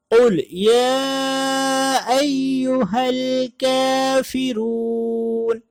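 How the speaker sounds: very slow, high, melodic tilawat; Quranic recitation. Acted. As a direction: chant the surah with long held vowels. Recites the surah.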